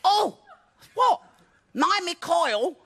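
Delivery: very high, slow put-on female alarm; hammed-up high-pitched woman in bed; startled woman imitation. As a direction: squeal it as the alarmed girlfriend. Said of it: "And then she said".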